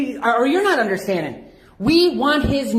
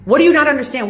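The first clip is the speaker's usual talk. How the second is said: angry demand; 4 semitones higher, 6 dB louder, faster than usual. Real, not acted. Demands officer's name at counter.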